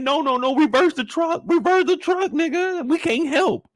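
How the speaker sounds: yelling in panic at the driver; panicked yell. Retold or imitated. To the driver mid-attack, a panicked high yell.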